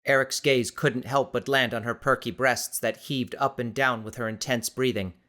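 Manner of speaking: ordinary delivery, nothing marked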